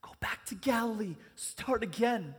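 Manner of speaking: calm a frightened friend; reassuring voice. Acted. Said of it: "the reassuring voice of Mark".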